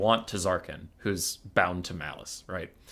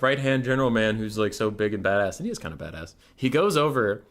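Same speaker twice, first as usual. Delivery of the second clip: ordinary delivery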